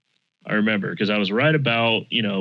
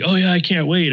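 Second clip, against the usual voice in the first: excited; 9 semitones higher, 3 dB louder than usual. Retold or imitated. Buddy's eager reply quoted.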